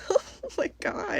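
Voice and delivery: high, slow, soft whimper; hammed-up overwhelmed little wail; mock tearful. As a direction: whimper it in exaggerated despair. Retelling overwhelmed tearful moment.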